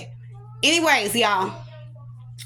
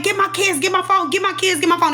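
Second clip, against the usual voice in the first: high, rapid-fire pleading; retold frantic demand; 8 semitones higher, faster than usual. Patient grabbed by hospital staff, retold frantic demands. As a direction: reel off demands, pitched way up. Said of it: "I'm going crazy".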